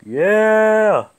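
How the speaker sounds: loud high victory shout; triumphant yell. Real, not acted. Gamer yelling at his screen after a kill, loud high triumphant shout. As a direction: roar it out after the win.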